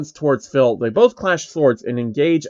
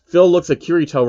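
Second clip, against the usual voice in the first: ordinary delivery, nothing marked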